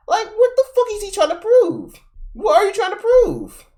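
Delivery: very high-pitched incredulous questioning; exasperated disbelief. Real, not acted. Streamer reacting to the man in the clip, exasperated.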